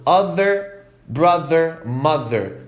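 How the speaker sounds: brother, mother, other with a buzzing voiced th; voiced th demo. Shown as a demonstration.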